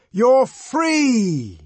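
high, loud proclamation; jubilant, ringing cry; triumphant shout. A narrator's reading. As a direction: announce liberation to the crowd. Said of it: "the savage shouted".

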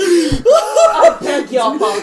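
hammed-up posh British indignation; British accent. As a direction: play an offended Brit. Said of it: "Holy shit, that was good".

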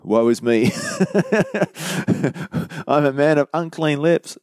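a lightly acted prophet in dismay, with a rueful laugh; Isaiah's lament. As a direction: play Isaiah caught short before God, half laughing. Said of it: "and he goes".

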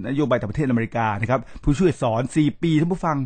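normal talking voice

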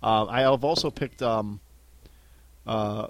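normal talking voice

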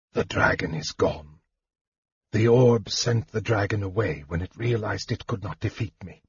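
soft, drained mage's voice; Raistlin, spent mage. Acted. Frail mage to the half-elf who caught him, weak and hushed.

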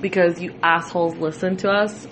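ordinary talk, nothing marked

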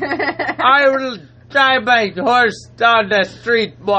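slow, drunk-sounding mock Jamaican; bad Jamaican accent. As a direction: slur through a terrible Jamaican accent. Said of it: "You just sound like you drunk as hell".

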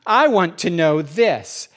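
slow, pointed quizzing; patronizing. Retold or imitated. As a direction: lay it out like to a child. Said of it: "he says".

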